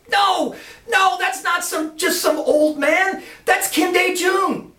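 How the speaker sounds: very high, loud and drawn out; aghast correction. Retold or imitated. Boss correcting Lance about the old man, high aghast exclaiming. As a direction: stretch the words in shrill disbelief. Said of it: "And the boss goes".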